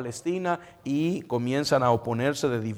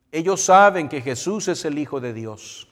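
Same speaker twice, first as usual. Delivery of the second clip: ordinary delivery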